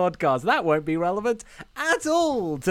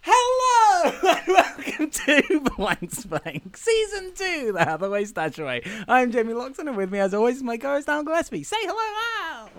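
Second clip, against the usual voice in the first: podcast intro; 7 semitones higher than usual. Acted. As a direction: welcome everyone like a big-show announcer.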